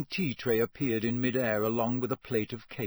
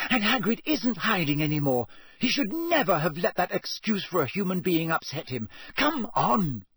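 angry; 7 semitones higher than usual. A narrator's reading. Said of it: "in a rage like this".

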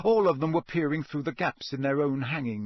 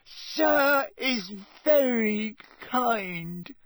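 high, very drawn-out house-elf voice; overwhelmed squeak; 9 semitones higher, much slower than usual. A narrator's reading. Dobby bows to Ron for the gift, overwhelmed squeak. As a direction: squeak slowly, deeply moved. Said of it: "he squeaked".

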